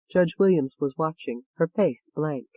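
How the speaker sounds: ordinary delivery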